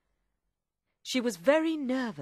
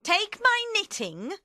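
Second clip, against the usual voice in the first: high, loud instruction; commanding high-pitched character; Madame Defarge; 13 semitones higher, 5 dB louder than usual. Acted. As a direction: give the order in a raised voice. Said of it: "Madame Defarge told Vengeance".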